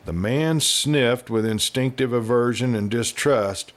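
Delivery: ordinary delivery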